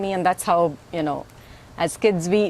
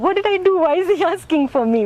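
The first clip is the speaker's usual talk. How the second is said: put-on high, louder, alarmed little girl; mock alarm; 11 semitones higher, 4 dB louder, faster than usual. Woman retelling her childhood fright when Swami called for her. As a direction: squeak out the panic. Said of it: "And I was scared".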